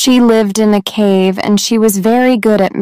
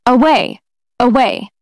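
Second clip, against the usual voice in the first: mildly exclaimed dismissal; crying out; 3 semitones higher than usual. A narrator's reading. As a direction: send him off with a restrained cry, slightly raised pitch. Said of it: "cried the priest".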